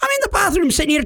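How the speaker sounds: high, fast mock yelling; hammed-up frantic answer; feigned excitement. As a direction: blurt it out to the scammer. Acts overexcited on prank call.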